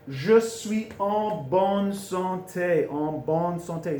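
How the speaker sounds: "Je suis en bonne santé" very slow, syllable by syllable; drawn-out, careful "Je suis en bonne santé"; slow teaching demo. Shown as a demonstration. Teacher models new phrase slowly.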